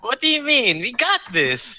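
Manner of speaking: high, eager and cocky; brash adventurer's boast; excited. Acted. Overeager character boasting.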